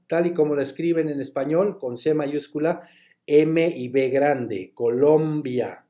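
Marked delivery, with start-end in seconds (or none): none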